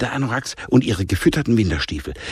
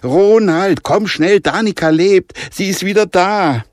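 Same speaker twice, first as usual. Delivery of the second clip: much higher and louder, calling to her husband; excited calling out; 10 semitones higher, 7 dB louder than usual. A narrator's reading. Mother calling husband with joyful news, loud excited call. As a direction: shout the news across the house. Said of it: "rief ihrem Ehemann zu".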